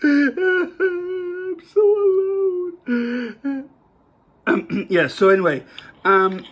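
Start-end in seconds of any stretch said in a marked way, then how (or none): 0.0-3.7 high hammed-up fake crying; mock sobbing. Joking to viewers about living alone, hammed-up fake sob. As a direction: wail it like a melodramatic sob.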